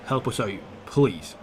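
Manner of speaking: ordinary talk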